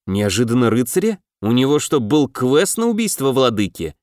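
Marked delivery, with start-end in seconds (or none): none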